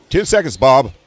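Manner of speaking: loud, high host countdown; booming host voice; game show host. Retold or imitated. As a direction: hurry the contestant like a TV host.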